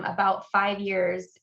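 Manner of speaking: ordinary talk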